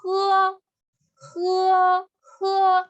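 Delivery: "he" held high and level; first tone. Shown as a demonstration. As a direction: sustain "he" on one high note. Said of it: "Igual con el primer tono".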